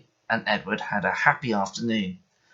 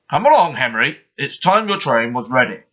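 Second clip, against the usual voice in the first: louder, firm command; firmly; 5 dB louder than usual. Acted. Fat Controller orders sulking Henry to his train, firm and louder. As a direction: tell him plainly to get moving. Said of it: "said firmly".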